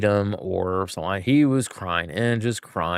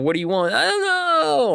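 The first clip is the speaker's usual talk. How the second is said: put-on whiny toddler. Dad and whiny toddler exchange.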